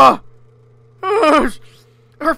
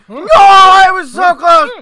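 slow, loud cry of defeat; frustrated shout; 4 semitones higher, 8 dB louder than usual. Real, not acted. Gamer shouting after just missing the goal. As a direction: yell it out, gutted.

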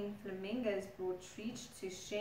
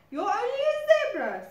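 high, loud, fast scoff; scoffing crocodile; 13 semitones higher, 12 dB louder, faster than usual. Acted. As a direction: scoff at the zebra quickly.

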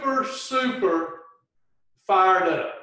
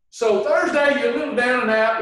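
ordinary delivery

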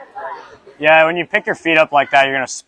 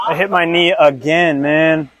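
louder and higher with every count; building exasperation; 5 semitones higher, 6 dB louder, slower than usual. Real, not acted. Gymnast complaining he hit his knee again. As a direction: count up, getting more fed up.

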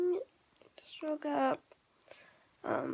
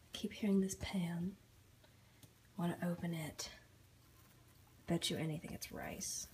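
whispering; 5 semitones lower, 7 dB quieter than usual. Real, not acted. Alone at home, hushed.

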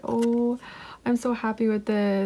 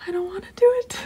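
anxious whine; 10 semitones higher, faster than usual. Real, not acted. Dreads scratching the card.

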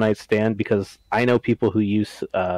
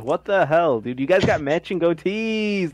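higher-pitched incredulous scolding; mock outrage; 8 semitones higher than usual. Host mock-scolding Taz over matching goatees. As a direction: rant at Taz in disbelief.

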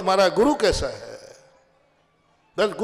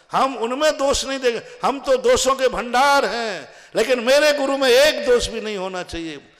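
raised, emphatic sermon voice; forceful preaching; 7 semitones higher, 8 dB louder than usual. Real, not acted. Preacher laying down a rule for devotees, forceful. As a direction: drive the principle home, stretching the key phrases.

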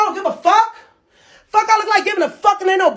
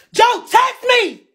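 emphatic outburst; 5 semitones higher, much slower than usual. Real, not acted. Indignant retelling of Joe's text.

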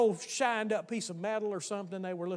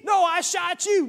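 put-on high loud childish protest; kid arguing; 10 semitones higher, 12 dB louder, slower than usual. The friend insists he hit the boy, loud high protest. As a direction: shout it back like an indignant playmate.

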